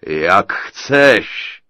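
loud booming giant; a hint of a cackling giant; chuckling giant. A narrator's reading. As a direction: laugh it off as the giant. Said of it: "zachechtal se obr".

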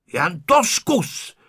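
slow defiant dare; bristling. A narrator's reading. Honzík defiantly daring Ferda. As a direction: puff yourself up and dare him. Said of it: "načepířil se Honzík".

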